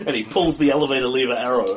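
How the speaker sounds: ordinary talk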